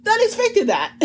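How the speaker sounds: laughing. Real, not acted. Laughing while retelling reaction.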